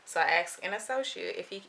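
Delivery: usual speaking voice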